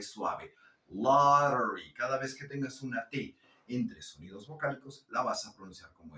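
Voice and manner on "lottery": lottery loud with tapped R for T; T as soft R. Shown as a demonstration. Teacher explaining the American T, lottery with soft R. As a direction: stretch "lah-reree", no hard T in lottery. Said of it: "pronunciar como una R".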